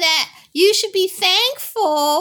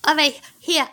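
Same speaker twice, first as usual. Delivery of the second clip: ordinary delivery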